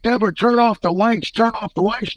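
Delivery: high urgent call to wife; urgent command. Retold or imitated. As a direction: hurry her to kill the lights. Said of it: "I'm like".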